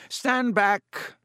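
grand stage command; showy command. A narrator's reading. Lockhart to the crowd, a lofty showy order.

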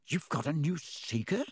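distracted. A narrator's reading. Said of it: "said Wood, distracted".

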